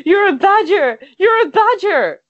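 high, loud tearful wail; weeping. Acted. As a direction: wail it as a tramp breaking down. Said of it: "He starts to weep".

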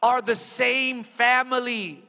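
ringing, emphatic pulpit delivery; full-force preaching. Real, not acted. Preacher reads scripture to the congregation, full-force preaching. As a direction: proclaim it with drawn-out emphasis.

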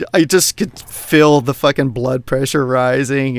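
ordinary talk, nothing marked

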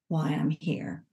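normal talking voice

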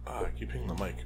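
soft whispered aside. Real, not acted. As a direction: whisper it so no one hears. Whispering apology near mic.

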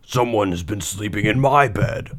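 great big bear voice, mild storybook shout; big, indignant bear, not truly shouting; Papa Bear. A narrator's reading. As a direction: boom it as a big father bear. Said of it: "shouted in his great big voice".

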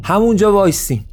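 restrained high-pitched shout; narrator's contained yell; shouted command. A narrator's reading. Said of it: "با یه اسلحهٔ شکاری روبروشون فریاد زد که".

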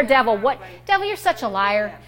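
usual speaking voice

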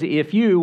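emphatic "If you" aimed at the believers; stress on if you. Shown as a demonstration. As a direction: punch "If you" to set believers apart. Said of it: "which is emphasized as a distinction".

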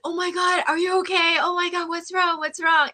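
high, panicked mother asking what's wrong; frantic worried mom; mother frightened by her gasping daughter; alarmed mom. Retold or imitated. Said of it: "my mom's like".

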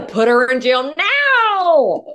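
loud, high, drawn-out mock yell; hammed-up screamed demand; furious mom. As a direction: wail the last word as an outraged mother. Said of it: "and your mom's like".

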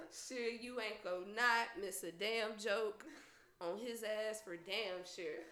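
soft, slow, low-key comeback; dry muttered reply. Retold or imitated. Daughter answering mama's promise to mock him, dryly muttered. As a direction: say it slowly under your breath, deadpan.

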